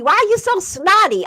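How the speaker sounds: irritated, fast and high; annoyed husband voice. Retold or imitated. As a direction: imitate the grumpy husband. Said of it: "my husband called me".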